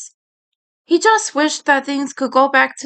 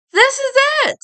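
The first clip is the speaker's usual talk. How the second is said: exclaiming; faster than usual. Acted. Eddie seeing the old factory, excited exclaim. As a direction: burst out with excitement. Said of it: "Eddie exclaimed".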